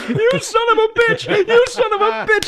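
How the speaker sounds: very high mock yell, laughing; hammed-up shriek. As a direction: scream it like a cartoon fighter.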